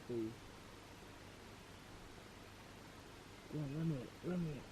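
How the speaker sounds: hushed whisper so fish aren't spooked. Real, not acted. Whispering while fishing at night.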